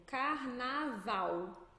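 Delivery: "carnaval" dragged out, ending in a U glide; L final como U. Shown as a demonstration. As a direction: say "carnaval" slowly, Brazilian final L as U. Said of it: "transforma esse L em U". ("carnaval" à brasileira, devagar, terminando em "vau")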